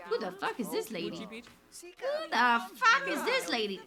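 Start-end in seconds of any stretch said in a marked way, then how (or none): 1.8-3.8 high, loud, slower outburst; loud disbelief. Real, not acted. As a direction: shout the question, leaning on each word. Repeats it louder at the stranger.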